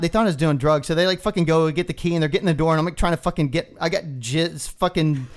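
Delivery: ordinary delivery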